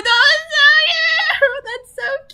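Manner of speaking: very high, loud, slow squeals breaking into cries; real overwhelmed delight, laughing and shrieking; ecstatic squealing. Real, not acted. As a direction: lose it over the babies. Squealing at cute slime.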